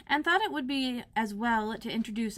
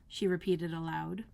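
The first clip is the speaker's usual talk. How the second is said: ordinary delivery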